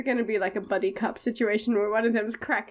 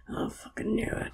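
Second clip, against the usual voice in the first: put-on gruff old cop whisper; 3 semitones lower, 8 dB quieter than usual.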